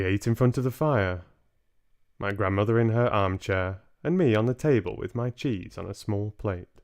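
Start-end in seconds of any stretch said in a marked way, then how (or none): none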